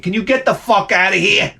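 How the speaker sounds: high-pitched mock outburst; exasperated inner voice. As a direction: imitate a silent rant, pitch jumping up. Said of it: "In my head, I'm saying".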